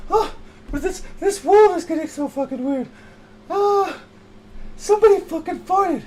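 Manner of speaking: high, loud indignant cry; hammed-up scandalized prude; outraged fussbudget. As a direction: shriek about the smell. Shrill outraged character.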